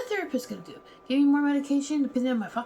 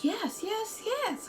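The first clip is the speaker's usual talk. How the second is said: much higher, quick repeated yes; eager yeses; 11 semitones higher, much slower than usual. Retold or imitated. The therapist agreeing to every question, high squeaky yes.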